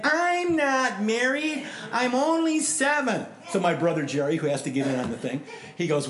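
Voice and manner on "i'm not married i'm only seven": much higher, drawn-out mock child voice; little girl's protest. Little girl answering whether she's married, mock indignant protest. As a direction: imitate a seven-year-old indignantly setting him straight. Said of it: "so I asked all little girls".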